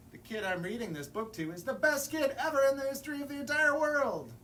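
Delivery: high, loud and fast gushing praise; excited praise. Acted. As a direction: rush through it, thrilled about the kid. Book praises the listening kid.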